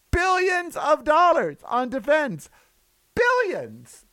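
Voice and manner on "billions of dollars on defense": ordinary talk, nothing marked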